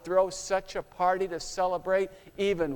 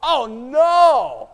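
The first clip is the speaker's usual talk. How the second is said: dismayed cry; 5 semitones higher, 8 dB louder, much slower than usual. Acted. Dreading being called on stage.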